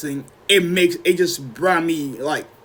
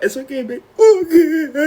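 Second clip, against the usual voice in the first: high, mock-tearful comforting; panicked reassurance; 10 semitones higher, 5 dB louder than usual. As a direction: soothe her in a high, overwhelmed voice. Reassuring sobbing girlfriend while losing control.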